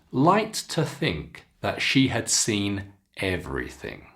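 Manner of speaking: clear, expressive teacher read-aloud; careful storybook reading for students; model reading aloud. Shown as a demonstration. Teacher reads model passage aloud.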